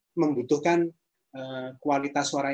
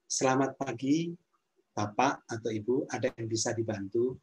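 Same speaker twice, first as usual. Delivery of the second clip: calm, friendly greeting in a mid, even pitch; friendly service greeting; 2 semitones lower, 3 dB quieter than usual. Shown as a demonstration. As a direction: greet a guest kindly, pitch kept modest. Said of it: "intonasi yang ramah itu intonasi".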